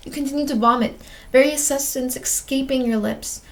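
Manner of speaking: plain reading voice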